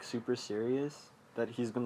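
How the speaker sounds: usual speaking voice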